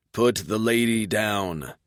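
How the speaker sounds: slow, level guard voice; calm and measured threat; even voice. A narrator's reading. Japanese guard blocking the path, slow even voice. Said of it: "the Japanese man says in an even voice".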